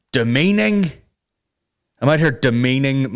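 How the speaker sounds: mocking the accusation. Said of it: "'Cause apparently I'm out here".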